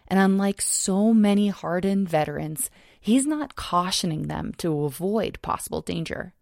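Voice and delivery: normal talking voice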